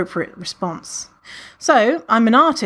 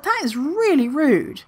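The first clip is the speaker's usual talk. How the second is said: offended; 7 semitones higher, slower than usual. Retold or imitated. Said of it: "And I was just like".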